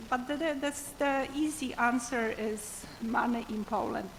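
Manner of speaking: ordinary talk, nothing marked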